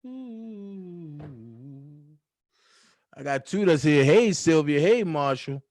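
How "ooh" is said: very quiet, long held "ooh"; soft sung ooh. Real, not acted. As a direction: croon a faint, gliding note. Trails off singing.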